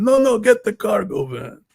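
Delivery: very high, loud mock Italian accent with a long stretched "No"; Italian pizzeria guy. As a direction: insist on the "cargo van" in a shrieking hammed-up Italian pizzeria voice. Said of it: "Italian guy, like a pizzeria".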